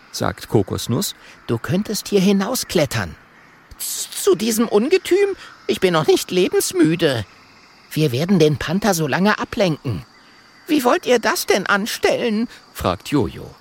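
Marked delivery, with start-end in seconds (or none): 1.5-3.2 high, small dragon voice; high-pitched young dragon; little dragon. Acted. 3.8-7.2 high, stammered protest; high-pitched alarmed refusal; scared little monkey. Acted. 7.9-10.1 little dragon. Acted. 10.7-12.5 high, doubtful monkey voice; high-pitched monkey character; little monkey. Acted.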